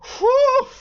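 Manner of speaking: high, loud whoop of relief; a loud whistling exhale of shock; loud whew. Real, not acted. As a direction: let out a big whew, way up high. Shocked by the game's ending.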